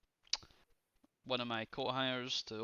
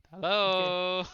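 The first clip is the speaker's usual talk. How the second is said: shouted hello through a door; calling out; 7 semitones higher, 10 dB louder than usual. Pretend. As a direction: call out loudly to whoever's inside.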